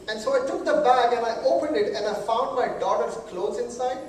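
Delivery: normal talking voice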